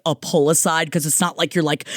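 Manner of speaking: ordinary talk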